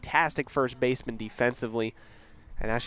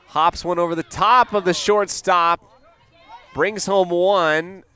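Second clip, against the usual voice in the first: lifted, louder call as the play unfolds; excited play-by-play; 5 semitones higher, 5 dB louder than usual. Real, not acted. Commentator calls a hit bringing a run home, excited. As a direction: punch up the hit with rising energy.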